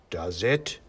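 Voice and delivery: low, doubting big-man voice; Father Christmas. A narrator's reading. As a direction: question it darkly. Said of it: "said Father Christmas".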